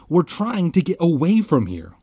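high, urgent protest; crying out. A narrator's reading. Ben explains to the gray circle, crying out. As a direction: plead your case loudly. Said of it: "Ben cried".

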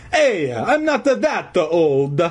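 put-on slow, high Italian accent; offended old Italian janitor. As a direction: protest slowly in cartoon Italian. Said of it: "in his hoarse Italian voice".

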